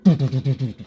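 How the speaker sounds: a short burst of real laughter; laughing. Real, not acted. Laughing while telling the car story. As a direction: laugh it off, amused at yourself.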